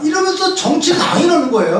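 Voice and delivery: ordinary talk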